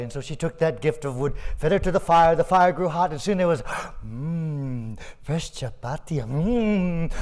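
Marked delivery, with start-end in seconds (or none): none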